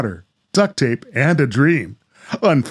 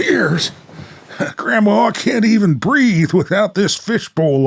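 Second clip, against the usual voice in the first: higher, exasperated grandson voice; young Tom; 8 semitones higher than usual. Acted. Tom to Grandma about the fishbowl helmet, exasperated and higher. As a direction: play the worried kid grandson.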